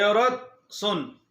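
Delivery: a slow, lightly commanding hail; calling out. A narrator's reading. Arrogant man hails a woman selling goods in the market, calling out. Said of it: "आवाज़ लगाई".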